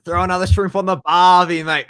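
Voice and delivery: hammed-up Aussie accent; a put-on Australian twang. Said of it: "give him your best Australian pronunciation".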